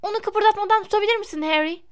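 high, quick, eager request; excited boy. A narrator's reading. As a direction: ask like a thrilled young fan. Eager boy wants a photograph.

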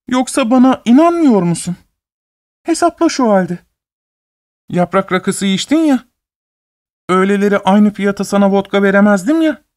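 high, hurt landlady voice; hurt young woman. A narrator's reading. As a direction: protest high and wounded. Narrator voices Sofya at length.

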